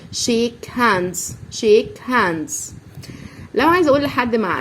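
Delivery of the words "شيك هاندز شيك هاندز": شيك هاندز spoken slowly, s sounded at the end; slow model word. Shown as a demonstration. Teacher modelling شيك هاندز slowly for pupils. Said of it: "فبقول هاندز".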